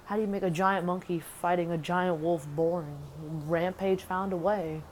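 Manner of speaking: ordinary talk, nothing marked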